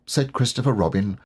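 ordinary delivery

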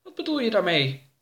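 high character voice; high-pitched puzzled question; puzzled questioner. Acted. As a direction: ask high, falling at the end. Oroka asks again.

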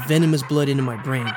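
ordinary delivery, nothing marked

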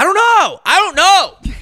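high, loud retold outburst; hammed-up flustered yelling; exasperated shout. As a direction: yell it, fed up with questions. Reenacts exasperated answer to grilling.

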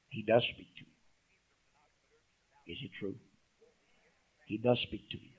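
soft, slow assurance; reassuring. Real, not acted. Pastor reassuring doubting listeners, soft and slow. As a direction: tell them gently he speaks. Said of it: "pastor assuring the congregation".

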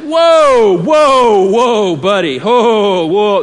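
loud, firm parental whoa; loud stern parent calling a halt; grown-up voice. Retold or imitated. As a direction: stop the toddler in his tracks. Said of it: "that's my grown-up voice".

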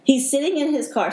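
holding back tears. Real, not acted. Retelling her rescue to the host, choked with emotion. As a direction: voice rising as emotion breaks through. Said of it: "really trying not to get emotional".

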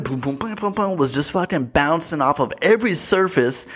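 ordinary talk

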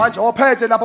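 ordinary talk

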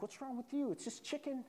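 puzzled friend. Retold or imitated. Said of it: "He's like".

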